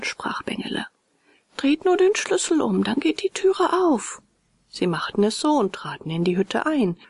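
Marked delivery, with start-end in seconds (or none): 1.6-4.2 high, tiny creature voice; high-pitched helper creature; little cricket voice. A narrator's reading. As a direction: call it out small and high. Same fine voice calls from inside.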